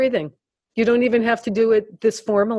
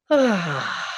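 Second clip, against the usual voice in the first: relaxed sigh. Shown as a demonstration. Leading group through relaxing sighs.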